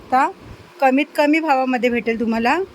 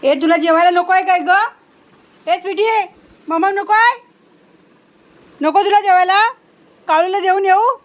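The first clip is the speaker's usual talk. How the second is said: high, loud sing-song coaxing; coaxing pet talk; 6 semitones higher, 7 dB louder than usual. Real, not acted. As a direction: coax the dog to come eat. Coaxing pet dog to eat.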